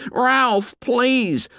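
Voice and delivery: put-on very high-pitched pleading boy voice; apprehensive plea. A narrator's reading. Piggy begs Ralph to stop, high apprehensive cry. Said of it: "clasped his hands in apprehension".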